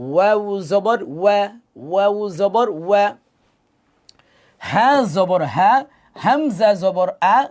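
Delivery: slow, drawn-out spelling chant; recitation drill. Shown as a demonstration. Teacher leading learners through letters, chanted drill. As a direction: stretch each letter name in a rising-falling lilt.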